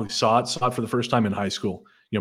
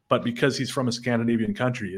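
ordinary delivery

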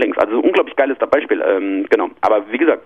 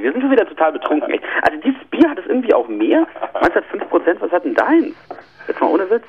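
tipsy, pitched up and giddy; 6 semitones higher than usual. Real, not acted. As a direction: speak like a few beers in. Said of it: "Ich fühle mich echt betrunken".

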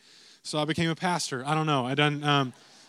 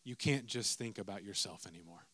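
soft, low, weighty delivery; quiet sermon punchline; solemn emphasis; 9 semitones lower, 11 dB quieter than usual. Acted. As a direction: let it land heavily. Preacher drives home key line.

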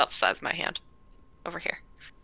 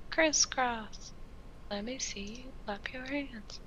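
kindergarten teacher voice; 6 semitones higher, 11 dB quieter, slower than usual. Pretend.

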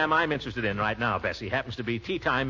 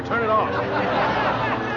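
slow emphatic; 7 semitones higher, much slower than usual. Acted. Repeating order slowly.

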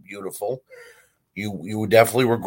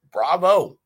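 a loud, high mock cheer; sneering mock applause; sarcastic bravo; 8 semitones higher, 6 dB louder than usual. Real, not acted. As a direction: applaud the failure with scorn.

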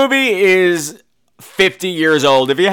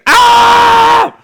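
long, high, loud shriek; drawn-out horror-movie scream; mock scream; 9 dB louder than usual. Mimics mother's scream.

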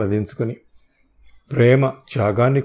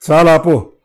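loud, high shout to send her away; angry shout; 5 semitones higher, 9 dB louder than usual. Acted. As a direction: bark it out in fury. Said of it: "అని అరిచాను". (కోపంతో గట్టిగా అరవడం)

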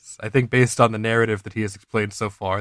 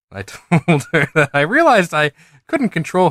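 amused, barely holding it together; laughing while reading; 4 semitones higher, 3 dB louder than usual. Real, not acted. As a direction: laugh bursting in after the first word. Reader cracks up at the story.

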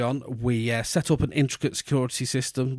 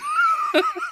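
shrieking laugh. Real, not acted. Laughing at own act.

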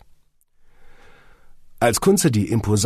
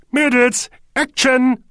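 high, loud cue; cheerful shout; 7 dB louder, slower than usual. A narrator's reading. Horsti cueing the three ladies, shouting cheerfully. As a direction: call action brightly at full voice. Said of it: "rief Horsti gut gelaunt".

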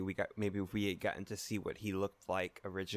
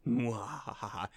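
slow hammed-up evil cackle; sneering panto villain laugh; 3 semitones higher, much slower than usual. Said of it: "Evil people doing evil things".

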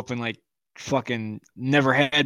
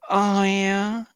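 higher, drawn-out silly moan; hammed-up delight; mock pleasure; 6 semitones higher than usual. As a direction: pretend to relish the pain. Mock enjoyment of pain.

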